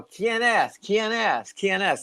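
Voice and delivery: mock yell to whoever is out there; retold yell. Yelling at the gate to see who's there, mild high-pitched yell. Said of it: "and I yell".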